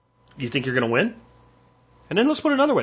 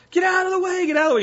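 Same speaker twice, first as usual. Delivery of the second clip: high yelling movie-quote voice, much higher; Austin Powers steamroller guy; faster than usual. Retold or imitated. Quoting the Austin Powers scene to viewers, high panicked yell. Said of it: "like the guy in Austin Powers movie".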